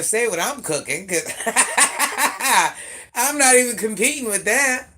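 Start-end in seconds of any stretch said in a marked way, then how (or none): 1.3-3.1 big high burst of laughter; cackling laugh at the competition; loud high laugh. Real, not acted. Laughing at rival's dish. 3.1-4.9 said high and loud through laughter; loud laughing concession. Real, not acted. Laughing off the competition.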